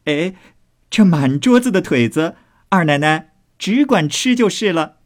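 high, playful call across. A narrator's reading. Maid telling her mistress to eat legs, calling out high. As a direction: call out teasingly, pitched well up. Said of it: "高声又说道".

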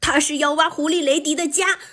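high, rushed, panicky rabbit cry; alarmed shriek. A narrator's reading. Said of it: "彼得兔大声尖叫道". (惊慌地尖声叫道)

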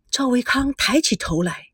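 plain reading voice

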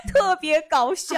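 laughing through the words, high and slow; can barely get it out for laughing. Real, not acted. Laughing at the driver story.

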